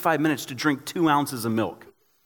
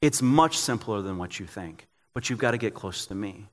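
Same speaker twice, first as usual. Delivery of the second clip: gentle voice of God, repeated; 7 semitones lower, 5 dB quieter than usual. Retold or imitated. God repeats his invitation to a tired pastor, low and tender. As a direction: lower and softer still, a tender insistence. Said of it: "God's sitting there going".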